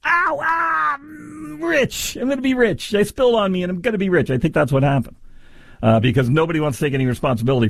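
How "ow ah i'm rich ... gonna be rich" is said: high fake yelp of pain, then gloating over getting rich; mock greedy victim. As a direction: ham up a cry of pain that turns into glee. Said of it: "everybody goes".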